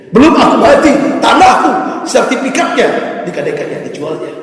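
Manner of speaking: put-on father shouting at children grabbing his land; furious shout. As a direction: roar it at the greedy heirs.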